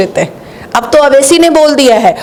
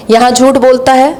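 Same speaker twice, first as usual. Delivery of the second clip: usual speaking voice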